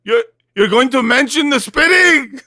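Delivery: high, loud, exasperated mock rant; imitating Bibi's inner outburst. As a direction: blurt it out, pitched high and indignant. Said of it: "Bibi is right now going like".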